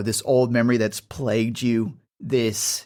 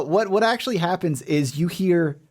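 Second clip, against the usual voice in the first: ordinary talk, nothing marked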